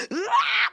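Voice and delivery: sudden high yelp; yelp of pain. Retold or imitated. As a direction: cry out as the foot gets pressed. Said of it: "squirm and go".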